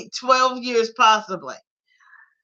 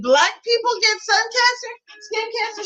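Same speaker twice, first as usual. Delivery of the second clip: shocked question; 12 semitones higher than usual. Retold or imitated. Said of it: "I said".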